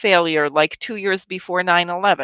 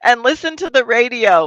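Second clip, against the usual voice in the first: higher, louder mock instruction; mock PSA lady; 7 semitones higher, 6 dB louder than usual. Ridiculing the nuclear video's host on air, raised and louder. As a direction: parody her bright official advice, pitched up. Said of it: "And then she says".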